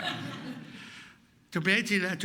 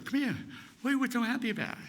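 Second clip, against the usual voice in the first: high-pitched, curious mother; mother's voice; 8 semitones higher, faster than usual. Retold or imitated. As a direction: call the child over in a high voice. Mother greeting happy child, retold.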